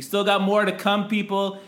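loud, high hype-man delivery; hyped announcer. Real, not acted. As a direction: pump up the crowd. Host hypes segment loudly.

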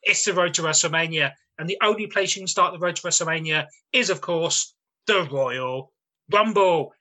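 loud ring announcer opening; ringmaster. Acted. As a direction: boom it out like a ringmaster.

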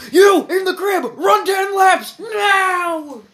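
very loud, high, slow drill sergeant bark. Pretend. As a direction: bellow orders at a recruit. Colonel orders the baby.

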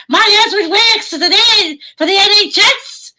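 very high, loud, hammed-up Scouse; shrieky-high Scouse impression; Scouse accent. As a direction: belt it out in an over-the-top Scouse voice. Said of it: "I was talking like that".

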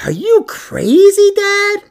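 put-on slow, very high child's voice; little son. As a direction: imitate an incredulous little boy. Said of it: "with the most serious look".

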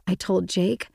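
ordinary delivery

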